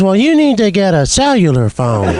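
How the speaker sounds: lightly voiced old grandma; a hint of a sweet grandma.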